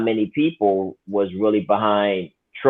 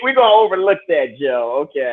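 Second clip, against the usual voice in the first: sarcastic; 5 semitones higher than usual. Pretend. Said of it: "I'm just being sarcastic".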